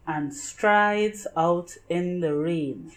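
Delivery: ordinary delivery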